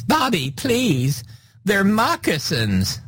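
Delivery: high, slow pleading voice; pleading wife. Retold or imitated. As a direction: beg him in a high voice. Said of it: "poor Cheryl Hines".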